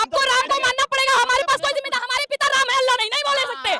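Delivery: 2 loud and high, insistent and fierce; 3 shout her down, no room for reply; 1 angry shout. Real, not acted. 4 woman shouting at her opponent that Ram must be accepted, fierce loud. Heated street debate over religion.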